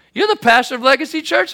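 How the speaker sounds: high-pitched imitation of an eager zookeeper; acted eager question. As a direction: play the zookeeper checking who he has met.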